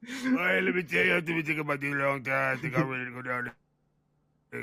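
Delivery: loud, hammed-up Cajun demo. Shown as a demonstration. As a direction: show off a big Cajun voice. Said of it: "Do Cajun".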